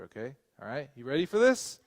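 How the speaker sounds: plain reading voice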